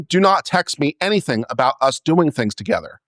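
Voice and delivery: normal talking voice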